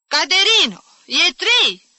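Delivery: high, loud summons; shrieked reminder of the hour; insistent calling. A narrator's reading. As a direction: call out again and again, insisting. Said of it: "insistă domnișoara obișnuită".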